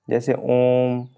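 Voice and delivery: ओम held very long and slow; drawn-out ओम. Shown as a demonstration. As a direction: stretch ओम to triple length. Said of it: "ये कितना बड़ा बोला जा रहा है". (ओम को तीन गुना लंबा खींचना)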